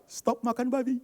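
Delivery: high, loud punchline; comic proclamation. Acted. Speaker giving his plate a new meaning, comic proclamation. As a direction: call it out high like a slogan. Jokes plate now means stop pork.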